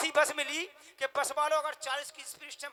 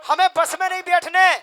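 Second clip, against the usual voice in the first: higher, louder stubborn refusal with a laugh; loud mock refusal; 5 semitones higher, 13 dB louder than usual. Woman refusing the bus, loud and laughing. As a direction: hammer home her absurd refusal, laughing. Same refusal repeated, punched up.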